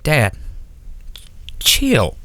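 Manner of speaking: calm him down half-jokingly; imitating younger self. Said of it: "And I was like".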